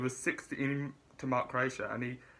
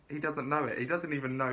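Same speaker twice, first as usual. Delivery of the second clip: normal talking voice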